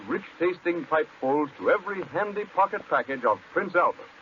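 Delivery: ordinary talk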